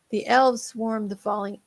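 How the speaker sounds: ordinary delivery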